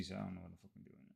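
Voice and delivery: a little tipsy, talking fast. Real, not acted. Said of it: "I'm tipsy".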